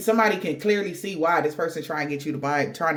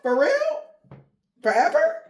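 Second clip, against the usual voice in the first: high squeaky mock shock on for real; hammed-up heartbroken questions; stunned disbelief; 10 semitones higher than usual. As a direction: climb up in disbelief, then settle. Panicked lover voiced.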